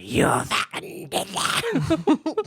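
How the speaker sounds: high, very slow menacing accusation; evil old teacher. Retold or imitated. Teacher accuses the first grader, high and drawn out. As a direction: jab each word like a finger in the face. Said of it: "evil old lady screaming in my face".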